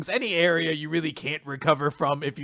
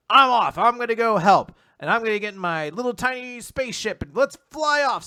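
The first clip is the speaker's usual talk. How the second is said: high, loud and gung-ho; mock eager announcement; 8 semitones higher, 6 dB louder than usual. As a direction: announce the departure like a keen little hero. Acts Rebecca eagerly setting off.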